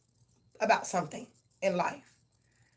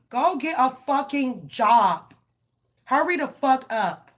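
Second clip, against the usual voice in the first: louder and higher, yelling orders; angry shout; 4 semitones higher, 6 dB louder than usual. Real, not acted. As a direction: bark it at someone who needs a job. Ranting at a stalking ex.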